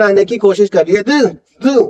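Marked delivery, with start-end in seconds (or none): 1.0-1.8 "दु" with tongue tip out between the teeth; TH sound. Shown as a demonstration. Teacher demonstrating TH, dental "दु". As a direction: let the tongue slip forward on "दु". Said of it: "दोनों जो दांत हैं उसके बीच".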